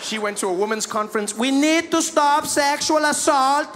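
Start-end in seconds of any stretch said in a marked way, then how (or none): 1.3-3.7 put-on higher, slow Slovenian-accented speech; slowly, in Melania's Slovenian accent. Melania at women's conference, slow accented speech.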